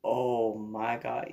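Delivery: low, slow, soft oh my God; dismayed sigh. Retold or imitated. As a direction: sound stunned at the mistake. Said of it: "looks over and he goes".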